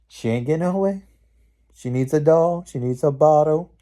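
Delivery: high, loud mock pity for a big baby. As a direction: taunt her as if she were a baby. Mocking the witch as a baby.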